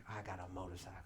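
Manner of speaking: low, very soft; sitting on the new bike, hushed; awed murmur. Retold or imitated. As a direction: breathe it in quiet awe. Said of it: "I was like".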